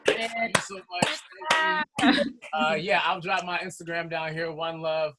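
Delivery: ordinary talk, nothing marked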